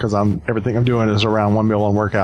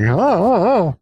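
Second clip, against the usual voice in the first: mock scared gasps; 9 semitones higher, slower than usual. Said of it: "that was just like".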